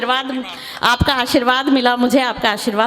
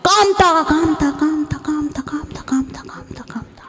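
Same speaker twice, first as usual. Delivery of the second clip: name echoing off, fading to a whisper; slower than usual. Retold or imitated. Poet imitates the hill echoing Kamta, fading to a whisper. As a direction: let the echo die to a whisper.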